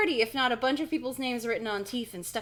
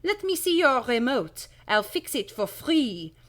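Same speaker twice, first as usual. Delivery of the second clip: higher, hammed-up French accent; 5 semitones higher, 7 dB louder than usual. Shopkeeper offering kids a free repair, French accent. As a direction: coax them in a put-on French voice. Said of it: "I've decided he's French".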